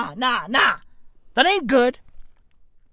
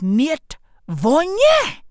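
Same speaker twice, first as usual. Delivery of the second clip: "Niet vonye." spelled out slowly for confused listeners; slow repeat for them; 4 semitones higher, much slower than usual. Retold or imitated. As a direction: drag out "Niet vonye." word by word. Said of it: "I say it in Russian".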